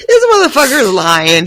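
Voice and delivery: loud, high accusation; outraged shout. Real, not acted. Reader outraged at the lying doctor, loud and high. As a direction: call him out loudly.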